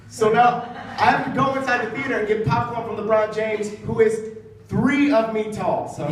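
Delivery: normal talking voice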